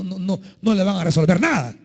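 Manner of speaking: normal talking voice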